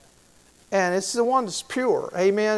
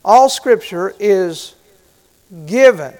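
slow, emphatic verse reading; scripture reading; 8 dB louder, slower than usual. Acted. Preacher reading the verse to the church, slow and weighty. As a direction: lean on every word of the verse. Reads the key verse aloud.

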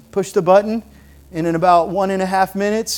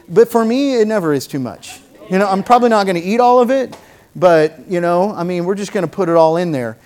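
ordinary talk

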